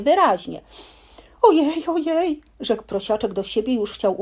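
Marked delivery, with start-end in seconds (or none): 1.4-2.4 frightened squeak. Acted. Piglet frightened near trap.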